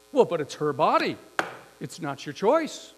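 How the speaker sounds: loud, angry retort, retold; acted-out burst of anger. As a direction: snap back as the indignant woman. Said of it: "turns to me very angry".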